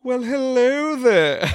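high, slow, laughing flirt; flirty greeting. Pretend. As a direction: purr it out like a cheesy flirt. Trying out pickup lines.

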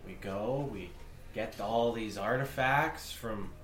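slow, rising to loud and high; interrogating noble. Acted. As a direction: press him for answers. GM holds the noble's voice.

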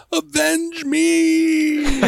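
high, drawn-out cry to the sky; hammed-up dying plea, very slow; overacted death scene. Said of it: "I heard a voice go".

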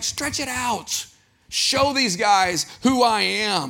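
slow, high, drawn-out angry command; Jesus confronting the critics, slow angry command. Retold or imitated. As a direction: draw out each word in controlled anger. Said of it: "He was like, he was angry".

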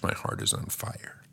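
soft whispered devotion. Acted. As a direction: breathe it as a whisper. Jesus repeats love line.

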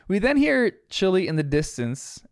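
ordinary talk

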